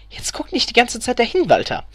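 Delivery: high-pitched wifely scolding; admonishing. A narrator's reading. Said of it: "ermahnte Elfriede ihren Gatten".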